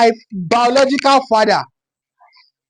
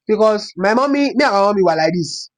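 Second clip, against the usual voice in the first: normal talking voice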